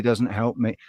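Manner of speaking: ordinary talk, nothing marked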